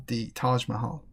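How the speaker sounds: ordinary talk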